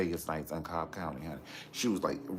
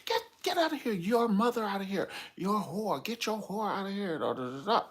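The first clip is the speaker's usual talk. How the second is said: high, loud shrieking yell at the mother; furious screaming; 5 dB louder than usual. Retold or imitated. Portia ordering Dennis's mother out, shrieking high and loud. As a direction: scream her off like a raging fiancée. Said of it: "Portia was like".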